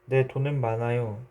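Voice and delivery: ordinary delivery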